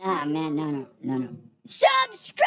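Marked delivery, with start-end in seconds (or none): 1.6-2.5 high screamed subscribe; screaming. Real, not acted. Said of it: "enough screaming".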